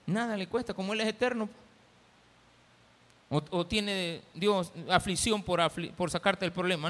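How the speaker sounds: ordinary talk, nothing marked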